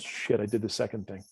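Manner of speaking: low, soft retold confession; sheepish admission. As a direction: imitate someone quietly owning up to a mistake. Client sheepishly admits her mistake.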